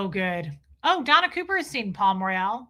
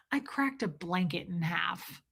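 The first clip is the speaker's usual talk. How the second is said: quiet deadpan quote; 4 semitones lower, 8 dB quieter than usual. Retold or imitated. Quoting the blonde lady.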